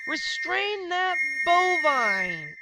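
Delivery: slow, loud, high guard shout; guard's cry. A narrator's reading. Museum guard chasing the cow, shouting. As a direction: bellow the order across the hall. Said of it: "a guard cried".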